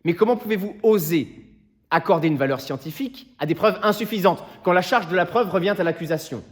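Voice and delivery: loud, high-pitched courtroom indignation; indignant challenge. Acted. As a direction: fire outraged questions at the prosecution. Lawyer challenges the prosecution in court.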